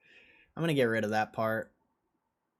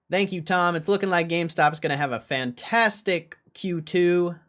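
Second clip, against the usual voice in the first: upbeat news anchor read; 4 semitones higher, 4 dB louder than usual. Acted.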